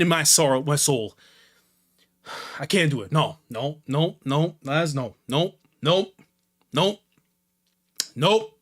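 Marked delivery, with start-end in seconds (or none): none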